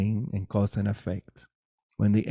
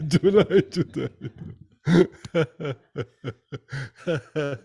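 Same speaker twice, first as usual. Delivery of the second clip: laughing; 6 semitones higher, 9 dB louder than usual. Real, not acted. Giggling at July's comment.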